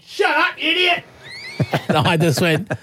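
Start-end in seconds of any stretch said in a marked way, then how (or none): 0.1-1.0 angry stranger. Retold or imitated. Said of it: "he's going".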